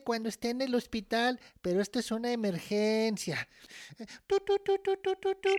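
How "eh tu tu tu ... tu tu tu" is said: high, even beeping syllables; evenly repeated phone beeps on one note; imitated dial tone. Child calls mom at hospital, phone ringing imitation.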